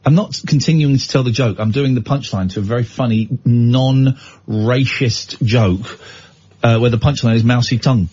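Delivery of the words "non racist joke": slow drawn out. Real, not acted. Stretched slow aside.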